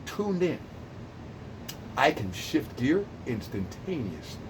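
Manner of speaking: slow, soft and settled; calm slowed voice. Shown as a demonstration. Calming the caller down, slow and soft. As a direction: ease right down to calm. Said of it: "I'm not like this all the time".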